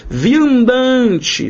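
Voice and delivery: Viandante called out high; announced word. Shown as a demonstration. Teacher announcing the next example word to the class. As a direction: lift Viandante like a title. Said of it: "via andante".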